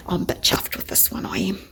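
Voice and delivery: soft, whispery admission of pride; quietly chuffed, almost whispered; hushed confession. Real, not acted. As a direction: confide it under your breath. Confides pride after promising less showing-off.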